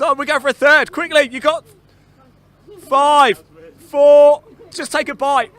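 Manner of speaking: loud, high countdown cheer; rally them with a yelling countdown; excited shouting. Real, not acted. Urging contestants in final seconds.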